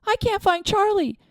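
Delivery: high, loud panicked calling; frantic yell. A narrator's reading. Aunt yells for lost nephew, high frantic shout. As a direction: cry out searching the dark. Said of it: "she yelled".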